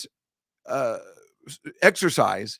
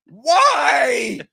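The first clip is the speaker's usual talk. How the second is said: high, loud, wailing why; mock anguished cry; 12 semitones higher, 9 dB louder than usual. Continuing the bit about the twins, a fake grieving cry. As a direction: cry it to the heavens in fake grief.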